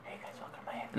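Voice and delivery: fast breathy whisper intro; ASMR whisper. Pretend. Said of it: "A S M R".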